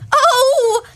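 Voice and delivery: high, loud British shriek; British scream. Shown as a demonstration. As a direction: demonstrate a posh British scream. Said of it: "that's the British".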